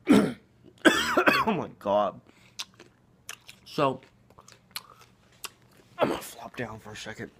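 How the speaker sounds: talking through a mouthful of carrots; mouth full. Real, not acted. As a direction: speak around a bite of food. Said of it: "talking with my mouth full".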